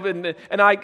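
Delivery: ordinary delivery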